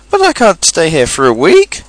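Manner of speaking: high rise into alarmed disbelief; dismayed protest. Acted. Pooh told he must wait, dismayed protest. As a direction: protest it as simply impossible.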